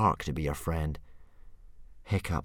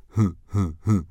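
snorting laugh; 6 dB louder than usual. A narrator's reading. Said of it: "snorted Dog's Breath".

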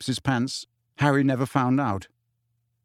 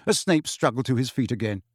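plain reading voice

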